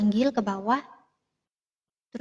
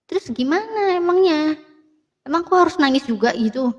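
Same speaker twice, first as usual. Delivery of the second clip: cheeky retort, higher pitch; stubborn, defiant; 5 semitones higher, 4 dB louder than usual. Retold or imitated. Younger sibling answers crying family, retold defiant retort. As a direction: talk back obstinately, imitating your younger self. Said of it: "kayak mokong, mokong gitu ya, kayak bandel gitu loh".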